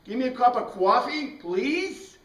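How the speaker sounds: hammed-up Bronx accent ordering coffee. Teacher imitating a Bronx local ordering coffee, Bronx accent. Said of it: "Just like when you go to the Bronx".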